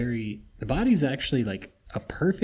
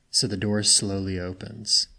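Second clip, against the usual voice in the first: usual speaking voice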